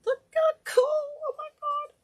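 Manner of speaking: very high, excited squeal; real delighted squeal. Real, not acted. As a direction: squeal with delight at your own creation. Delighted at how the craft looks.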